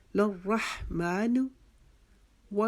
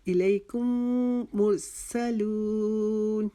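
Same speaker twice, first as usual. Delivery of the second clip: higher, slow chanted recitation; Quran recitation; 3 semitones higher, much slower than usual. Shown as a demonstration. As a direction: draw out مرسلون in a recitation voice. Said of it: "ایک الف کے جتنا رکے". (الیکم مرسلون کی آہستہ، کھینچی ہوئی تلاوت)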